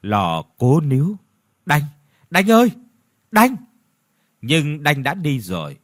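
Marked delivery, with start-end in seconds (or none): 1.7-3.6 urgent, high, loud calls; short pleading cries of the name; calling out. A narrator's reading. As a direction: call after a vanishing friend. Calling after the fading ghost.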